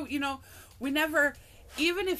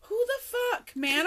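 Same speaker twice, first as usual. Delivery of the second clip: baffled outburst; 12 semitones higher, slower than usual. Retold or imitated. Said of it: "So I'm like".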